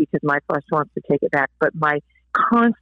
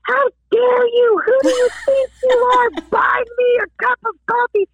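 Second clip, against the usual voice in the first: imitated high-pitched scream; screaming outrage. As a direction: shriek it like an outraged stranger. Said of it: "started screaming at me".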